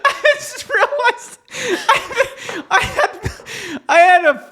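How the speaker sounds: high, laughing caffeine rush; giddy and wired on espresso; manic. Real, not acted. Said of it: "[name] is having a manic episode".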